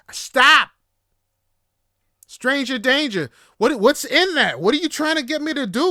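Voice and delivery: high, loud comic outburst; exasperated rant yelled at full volume; upset shouting. Retold or imitated. Yelling at a date who keeps pushing weed, upset. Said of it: "getting more and more upset".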